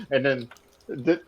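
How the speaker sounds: ordinary delivery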